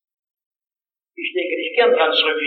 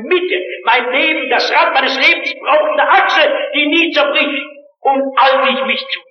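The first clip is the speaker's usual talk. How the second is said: from steady testimony to a fervent call; building sermon climax; 6 semitones higher than usual. Acted.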